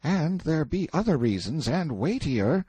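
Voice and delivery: put-on high, hesitant boy. A narrator's reading. Boy reluctant to tell prisoner the reasons, hesitant.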